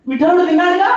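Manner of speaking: put-on boy proudly telling his mother the god ate; excited boy. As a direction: call it out gleefully as he runs. Boy answers proudly running off.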